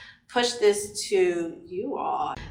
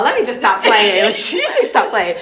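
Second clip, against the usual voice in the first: laughing; 6 dB louder than usual. Real, not acted. Laughs at herself.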